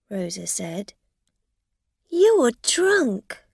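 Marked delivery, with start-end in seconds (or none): 2.1-3.4 high, loud scornful accusation; accusing. A narrator's reading. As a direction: throw the accusation at her. Rosa repeating accusation, higher.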